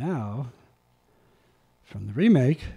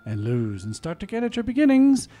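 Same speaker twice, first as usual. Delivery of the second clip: rousing recital that swells louder at the peaks; dramatic poem reading; 3 semitones higher, 12 dB louder than usual. Acted. Reading Rudyard Kipling's If aloud, dramatic poem recital. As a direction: read Kipling like a stirring speech.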